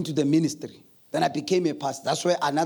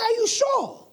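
wife's doubt; 3 dB louder than usual. Retold or imitated. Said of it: "And she said".

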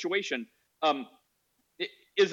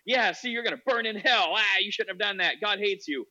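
higher-pitched, hammed-up scolding; mock condemning preacher; 5 semitones higher than usual. Zealot berating the distraught mother, mock condemnation. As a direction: imitate a gloating zealot damning someone.

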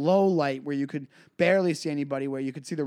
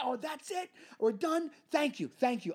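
high, overly polite dismissal; forced thanks; 10 semitones higher than usual. Retold or imitated. Comic retelling dismissing the host with high fake thanks. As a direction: thank him to shut him up. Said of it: "Something in me just snapped".

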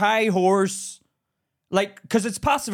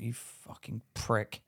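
muttered inner insult; 12 semitones lower, 14 dB quieter, slower than usual. Retold or imitated. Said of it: "I thought".

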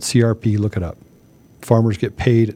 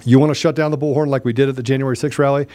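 ordinary talk

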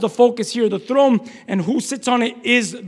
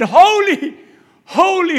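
high, raised "holy"; cry of praise; 7 semitones higher, 7 dB louder, slower than usual. Acted. Preacher asks if your life said it, crying praise. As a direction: call each word out separately. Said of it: "Has your life said".

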